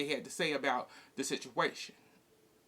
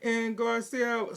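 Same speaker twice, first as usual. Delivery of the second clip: ordinary talk, nothing marked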